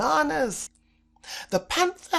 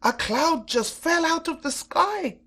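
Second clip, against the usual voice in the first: higher, alarmed cry; 7 semitones higher, 3 dB louder than usual. A narrator's reading. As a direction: shout the alarm. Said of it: "cried the cheetah".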